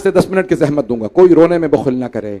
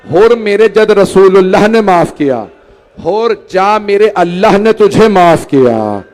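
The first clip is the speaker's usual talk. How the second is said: louder and higher, each line a rising declaration; forceful proclamation; 3 semitones higher, 5 dB louder than usual. Acted. Imam Husain forgiving Hur, proclaimed loudly.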